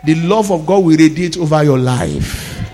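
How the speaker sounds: ordinary talk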